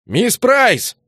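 high, loud shout; calling out. A narrator's reading. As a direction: call her across the street. Calling for the missing girl.

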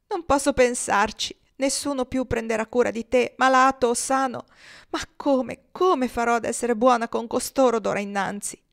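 high, loud, slowed tearful lament fading to a whisper; sobbing. Acted. Chloe sobs over the chest for her departing husband. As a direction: weep it out, breaking down. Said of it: "si pose a singhiozzare".

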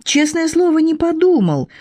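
high, pleading insistence; earnest pleading. A narrator's reading. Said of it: "змей искренне расстроился".

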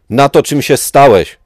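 a high, loud anguished shout; a furious cry from a betrayed friend. Acted. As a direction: scream it at him in despair. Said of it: "krzyknęła".

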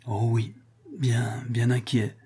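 slow, whispery, overcome stammer. A narrator's reading. Rodolphe, moved at seeing his daughter, stammers under his breath. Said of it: "balbutia Rodolphe".

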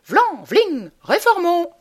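high, loud rallying cry of a blowhard candidate; rousing refrain. Acted. Candidate rallying voters, triumphant loud cry. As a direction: belt it out like a campaign slogan. Comic refrain of a stump speech.

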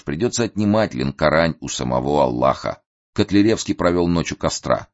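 plain reading voice

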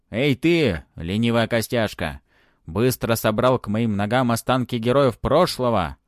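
loud barked command; shouting order. Acted. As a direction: yell at the lazy servant. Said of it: "прикрикнул". (грозный окрик)

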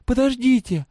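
high, loud shout; calling back. A narrator's reading. Girl calling back to a prisoner, shouting.

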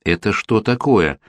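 ordinary delivery

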